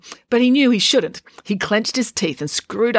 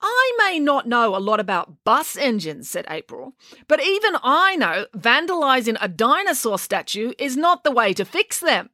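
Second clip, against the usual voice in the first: put-on indignant girl; 7 semitones higher than usual. A narrator's reading. April argues with the vandals.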